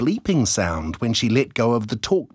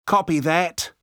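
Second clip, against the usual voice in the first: put-on higher, crisp kid signing off; pretend radio agent; 6 semitones higher than usual. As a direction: play a spy ending the call.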